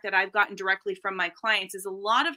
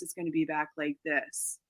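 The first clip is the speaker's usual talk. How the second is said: softer, lower voice sitting back in the chest; chest resonance; 5 semitones lower, 8 dB quieter than usual. Shown as a demonstration. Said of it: "masculinized voice is gonna be back in the chest".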